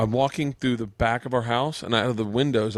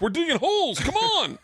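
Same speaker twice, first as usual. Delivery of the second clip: put-on high excited kid call; hammed-up kid shouting. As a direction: squeal it like eager kids. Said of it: "they're like".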